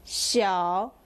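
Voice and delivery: "xiao" dipping low, then rising; third tone. Shown as a demonstration. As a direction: draw "xiao" down and back up. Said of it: "third accent".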